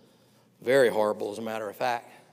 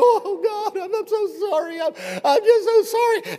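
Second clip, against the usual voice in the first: high, whiny fake sorrow; high blubbering mockery; mock whining repentance; 10 dB louder than usual. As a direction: mimic a fake penitent sobbing. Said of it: "it's like".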